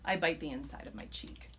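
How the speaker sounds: ordinary delivery, nothing marked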